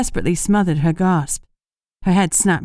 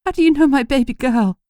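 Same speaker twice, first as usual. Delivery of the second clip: trembling voice; 7 semitones higher than usual. A narrator's reading. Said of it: "Her voice trembled".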